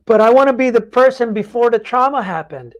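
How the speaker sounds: mock plea of someone clinging to the past; imitating a stuck person. Podcast host voicing a trauma survivor's wish, mock plea. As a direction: whine it like someone who won't let go.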